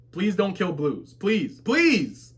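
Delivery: beg, building on each please; mock pleading. Fan begging for Blues to be spared, mock pleading. Begs author to spare character.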